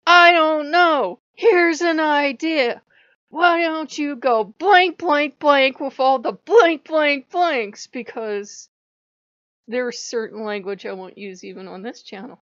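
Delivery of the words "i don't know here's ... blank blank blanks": loud, high, drawn-out sarcastic tirade; retold screaming. Speaker screaming back at Odin in a fight, mock-furious shout. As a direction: re-enact yelling back, slow and punched. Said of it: "Odin and I are screaming back and forth".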